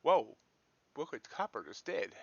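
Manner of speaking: ordinary talk, nothing marked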